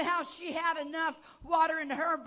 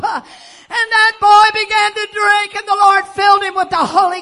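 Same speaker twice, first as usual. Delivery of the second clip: full-force preaching; 5 semitones higher, 16 dB louder than usual. Acted. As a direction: drive it home to the congregation, full voice.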